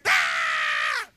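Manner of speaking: a deep, strange scream; demon shriek. Retold or imitated. Preacher voicing the demon leaving, deep shriek. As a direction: let the devil cry out. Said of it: "that devil went".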